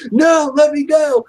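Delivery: high, loud, drawn-out protest; struggling yell. Retold or imitated. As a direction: fight your way out of his grip. Struggling in a bear hug.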